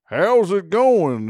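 high, loud, slow mock American accent; fake American accent. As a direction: imitate a Brit's overdone American accent. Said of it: "do an American accent".

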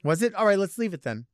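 normal talking voice

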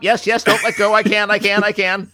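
high, loud, frantic pleading; mock panic. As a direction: beg as if the blade is about to fall. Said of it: "on a guillotine".

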